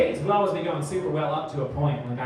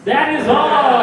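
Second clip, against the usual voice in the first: loud sign-off; 8 semitones higher, 11 dB louder, slower than usual. Real, not acted. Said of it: "sign-off".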